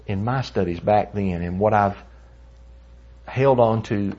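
normal talking voice

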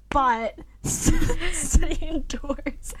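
laughing through the words; giggling nervously while talking. Real, not acted. Nervous laugh through timed talk.

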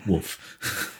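soft, voiceless little woof; a quiet, understated dog bark; dog's woof. Retold or imitated. Reader quotes the talking dog from a novel, soft woof. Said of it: "the dog was just going".

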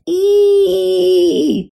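loud high-pitched squeal of delight. Pretend. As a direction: let out a sharp, high squeal. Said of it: "when I feel like".

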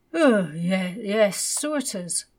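drowsy, lightly voiced mother; sleepy mummy. A narrator's reading. Mother woken at six by her son, drowsy voice. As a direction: mumble it half awake, as mummy. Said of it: "said his mummy".

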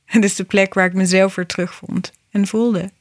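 normal talking voice

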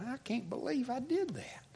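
high, hushed near-whisper; retold inner thought, softly aghast; regretful thought. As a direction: softly voice a pained disbelief. Recalls own regretful thought.

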